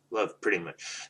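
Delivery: ordinary talk, nothing marked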